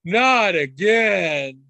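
high, loud, very slow drawn-out groan; mock exasperated child; weary kid groan. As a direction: stretch it out like a fed-up kid. Kid groaning at retold story.

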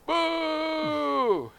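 long, high mock boo; crowd boo. Crowd rejecting the candidate, long high mock boo. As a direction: draw out a high-pitched boo. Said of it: "And they go".

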